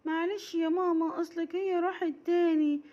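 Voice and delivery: put-on high pleading child voice; whiny little girl. Raghad excusing herself to mama, high child voice. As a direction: make the same excuse again.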